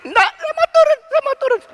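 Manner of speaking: put-on high, whiny little boy begging; scared child. Frightened son begging his father to get down. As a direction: plead like a frightened toddler.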